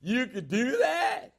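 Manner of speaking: high, slow, amazed squeak of a voice; Deacon Denny voice. Retold or imitated. Young salesman asking the preacher, in a put-on high amazed voice. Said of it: "he used the Deacon Denny accent".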